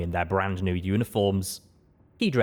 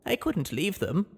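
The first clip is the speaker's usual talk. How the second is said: higher, gentle servant voice; maid character voice; 10 semitones higher than usual. Acted. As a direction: voice the timid ghoul maid. Ghoul pegasus maid speaking.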